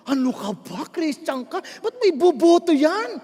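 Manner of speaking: hammed-up outrage, climbing at the end; mock indignant scolding. Church member attacking another's vote, mock indignant. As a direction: scold like an appalled fellow believer, drawing out the last question. Acting out quarrelling Christians over votes.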